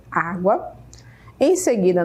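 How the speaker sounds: ordinary delivery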